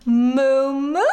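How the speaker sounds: lightly voiced cow moo. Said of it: "says Mister Cow".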